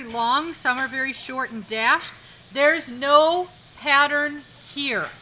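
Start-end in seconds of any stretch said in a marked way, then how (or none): 2.5-5.1 There's no pattern here, slow with no stressed. Shown as a demonstration. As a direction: slowly lean on the word no in There's no pattern here. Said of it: "make sure that we understand that there is no pattern here".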